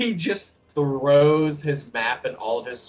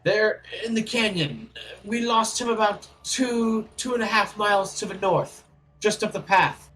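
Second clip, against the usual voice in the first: a touch higher, confident wizard boasting his plan; lightly voiced wizard; 7 semitones higher, 6 dB louder than usual. Dwarf wizard boasts his plan to the party, lightly voiced. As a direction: sell the plan like a proud little wizard.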